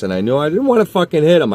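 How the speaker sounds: normal talking voice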